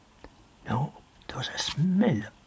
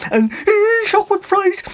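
much higher, louder, trembling terrified cry; fright cry; 8 dB louder than usual. A narrator's reading. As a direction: shriek it with terror. Narrator acts out terror.

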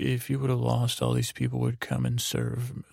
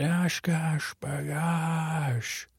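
very slow, higher, stretched-out rally call; rallying the villagers to charge, slow and higher; drawn-out battle cry; 4 semitones higher, much slower than usual. Retold or imitated. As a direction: drag every syllable like a war cry.

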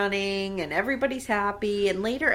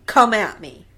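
loud, hammed-up challenge; mock dare; 8 dB louder than usual. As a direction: dare them to attack, play-acting. Sickle-wielding dare, repeated louder.

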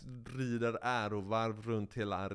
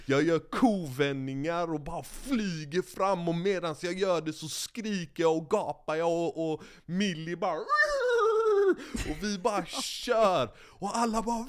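excited; 7 semitones higher, 6 dB louder than usual. Acted. Climax of the winning ride.

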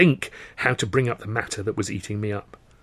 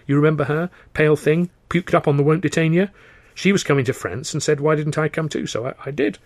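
lightly voiced dancer, pitched up; chatty story character; 6 semitones higher, 3 dB louder than usual. Dancer telling an old friend how she reached France, chatty. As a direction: chat lightly in a higher voice. Tilly tells her story.

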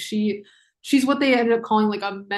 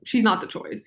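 ordinary talk

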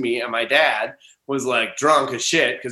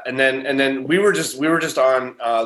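ordinary talk

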